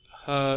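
slow, held "hơ"; long vowel. Shown as a demonstration. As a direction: stretch the ơ sound in "hơ". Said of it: "âm ơ ha".

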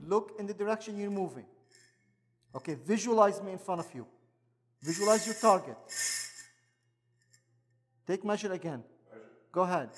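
crisp fencing commands. Real, not acted. Fencing instructor coaching student.

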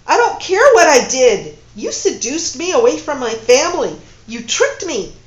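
very loud, high angry accusations; furious. Acted. Woman raging at her lover who tricked her, loud fury. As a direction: hammer him with each charge.

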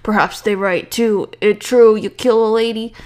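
loud, badly faked tough prisoner; bad guy voice. Prisoner accuses the new cellmate, put-on bad guy voice. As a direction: push out a manly villain voice. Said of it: "this is my bad guy voice".

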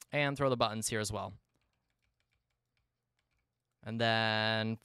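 normal talking voice

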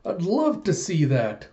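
high-pitched, eager pretend excitement; super excited. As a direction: act out an excited adventurer, pitch jumping up. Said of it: "just getting super excited".

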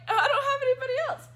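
high, fast and loud, through laughter; retold flustered outburst. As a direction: blurt it out in a laughing squeal.

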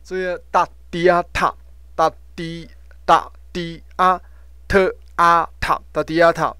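大迪啊塔 in separate loud syllables, aspirated "t" on 塔; teacher spelling out 大迪啊塔, puffed "t" in 塔; syllable-by-syllable demonstration. Shown as a demonstration. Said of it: "T H就是发特的音哈".

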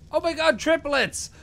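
high, loud delighted cry; excited exclamation; thrilled surprise. Real, not acted. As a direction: burst out with joy at the triplets. Egg hatches three babies.

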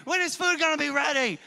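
loud, high-pitched mock child yell; impatient child. Hungry four-year-old demanding food from dad, impatient yell. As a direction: imitate a hungry kid hollering.

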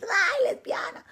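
high squeal of "Ay" fading to a breathy "lesbiana"; lightly mocking the confession. Mother picturing herself confessing, mock squeal. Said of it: "salir yo también allá a decir".

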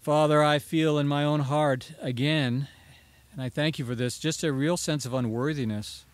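hushed congregational prayer. Real, not acted. Pastor leads closing prayer.

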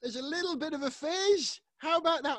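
triumphant little cheer; relieved excitement. Real, not acted. Can opens without spraying.

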